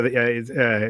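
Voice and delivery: ordinary talk, nothing marked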